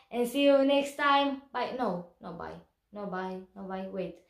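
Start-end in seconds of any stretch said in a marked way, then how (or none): none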